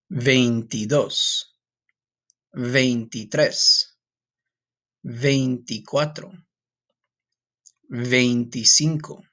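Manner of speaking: very slow, each number drawn out; slow counting. Shown as a demonstration. Teacher counting twenties slowly for students to repeat.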